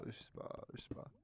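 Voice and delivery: breathy whisper, no voice. Real, not acted. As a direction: whisper it to yourself twice. Mumbling to self, unsure.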